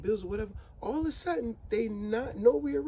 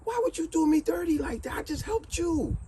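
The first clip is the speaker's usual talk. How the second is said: high, hurt, wailing protest; betrayed bird, much higher and hurt; 12 semitones higher than usual. A narrator's reading. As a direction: bird cries out at being betrayed. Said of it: "And then the bird said".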